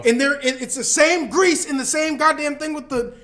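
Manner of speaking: loud, high-pitched complaint; exasperated rant. Real, not acted. Comic griping about cookout grease, loud exasperated rant. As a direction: hammer the point home loudly.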